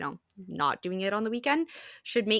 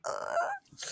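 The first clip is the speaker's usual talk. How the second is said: squealing ugh; 9 dB quieter than usual. Retold or imitated. Overwhelmed by cute opening.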